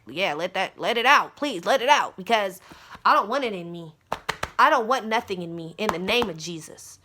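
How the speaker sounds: loud, emphatic begging; urgent plea. Retold or imitated. She begs for the demon's release, loud plea. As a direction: plead forcefully to be rid of it. Acting her plea to cast it out.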